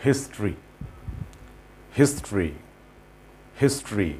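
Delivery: History said as HIS-tri, two syllables; history as his-tri, the middle vowel dropped; model pronunciation. Shown as a demonstration. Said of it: "these are not the right pronunciations".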